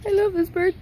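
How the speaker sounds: high, hammed-up weepy voice; fake-sob the line; badly faked tears; mock crying. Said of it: "I'm gonna cry".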